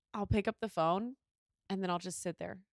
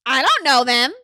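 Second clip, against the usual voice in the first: loud mock-mom refusal; dismissive mom; 14 dB louder than usual. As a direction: blurt it out as a brusque mom. Mom rejecting unknown phone call.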